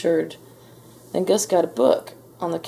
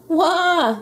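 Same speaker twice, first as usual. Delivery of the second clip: very high infant "wah"; baby ghost wail; 12 semitones higher, 4 dB louder than usual. A narrator's reading. Baby Ghost alarmed at the noise, wailing. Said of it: "yelled Baby Ghost".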